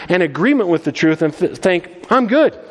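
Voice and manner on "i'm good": mock complacent, pitched higher; light mock of easy confidence; smug self-assurance. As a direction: toss it off like a self-satisfied believer. Mocking complacent churchgoers.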